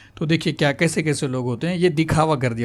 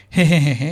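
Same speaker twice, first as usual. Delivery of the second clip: short embarrassed he-he-he, louder; sheepish laugh; 6 dB louder than usual. Retold or imitated. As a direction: chuckle it off, caught out. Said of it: "ऐसा हंसा". (झेंपी हुई हँसी, हे हे हे)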